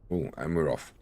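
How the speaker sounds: ordinary talk, nothing marked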